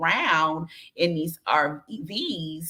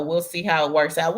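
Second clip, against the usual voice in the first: ordinary talk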